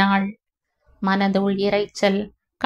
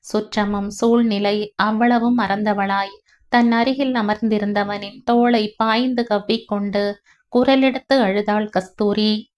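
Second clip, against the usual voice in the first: ordinary delivery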